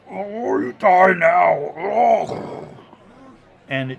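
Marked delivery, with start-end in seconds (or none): 0.1-2.7 loud, high, drawn-out ogre roar. Acted. Ogre swinging a great club at his foe, slow loud roar. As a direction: bellow it slowly while swinging hard. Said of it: "He goes".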